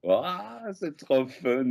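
high-pitched, delighted reaction; light up at flattering news; thrilled, voice leaping up; delighted surprise. Real, not acted. Delighted at news of fan cosplays.

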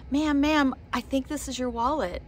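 lightly put-on high angelic voice; little angel voice. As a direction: imitate a little angel getting someone's attention. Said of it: "this little angel voice".

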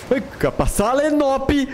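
sudden shrill yelp, high pitch; startled high yelp. Real, not acted. Sudden scare in game.

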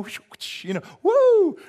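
woo flung up high and loud; horse-riding whoop. Pretend. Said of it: "riding horses".

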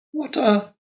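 put-on high, light young wife's voice; Carlotta's girlish voice. A narrator's reading. Narrator voicing the young wife to her husband, high and innocent. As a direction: lift into a childlike wife asking innocently.

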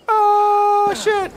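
very high, loud oh shit; yelped curse in shock; startled yelp. Real, not acted. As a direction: caught off guard mid-fall. Sudden fall or hit.

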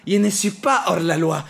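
high, loud indignant cry; heated protest. Acted. Fares insisting to the cop he is no outlaw, loud. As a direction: protest your innocence loudly.

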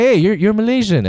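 high, eager hail; excited recognition. Acted. People recognise a Malaysian by speech, excited. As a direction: exclaim as if just placing the accent.

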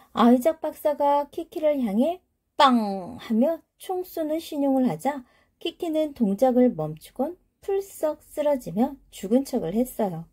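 ordinary delivery, nothing marked

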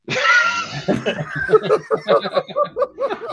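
high, long burst of laughing; real laughter. Real, not acted. Cracking up at the doily mix-up, high laughter.

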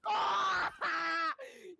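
loud shouted laugh. Real, not acted. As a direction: crack up loudly. Reacting to cheater through wall.

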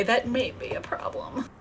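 whisper. Real, not acted. Trailing aside.

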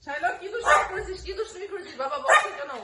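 high sing-song coaxing to a pet; pet talk. Real, not acted. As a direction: coax the pet gently, voice lifted. Coaxing the pet about mischief.

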